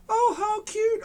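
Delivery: high cooing lady voice; lady gushing over the bunnies. Acted. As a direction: gush like a delighted lady. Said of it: "a lady said".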